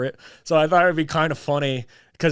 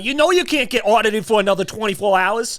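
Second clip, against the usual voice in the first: Brooklyn mook; 8 semitones higher, 5 dB louder than usual. Acted. Brooklyn dad scolding.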